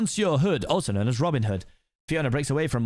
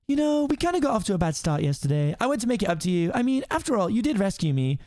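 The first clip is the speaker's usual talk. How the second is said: higher-pitched princess voice, conciliatory; apologetic princess; 6 semitones higher than usual. Acted. Princess making up to her rescuer, high and conciliatory. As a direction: make amends in a raised princess voice.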